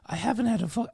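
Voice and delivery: put-on high Irish granny accent; chatty old Irish woman voice; Irish old lady voice. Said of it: "that's my Irish old lady voice".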